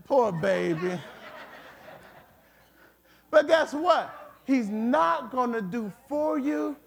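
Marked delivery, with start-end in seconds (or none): 0.1-1.0 lowered, consoling voice; comforting. Acted. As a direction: soothe like a sympathetic comforter. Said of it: "he'll sit with you and say".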